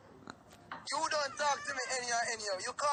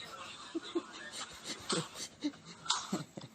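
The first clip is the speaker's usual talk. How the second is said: breathy gloating laugh; 3 semitones lower, 7 dB quieter than usual. Acted. Gloating after bragging.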